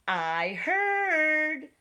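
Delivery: high bird voice. A narrator's reading. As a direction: chirp it like a bird. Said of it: "said the bird".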